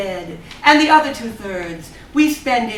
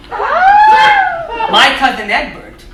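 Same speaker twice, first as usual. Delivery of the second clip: much higher, slow indignant echo; outraged disbelief; 4 dB louder, much slower than usual. Acted. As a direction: throw the name back in shock. Husband indignant at insult to family.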